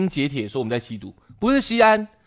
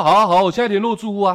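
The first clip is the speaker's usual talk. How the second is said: mocking quote; 7 semitones higher, 4 dB louder, faster than usual. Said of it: "然后说".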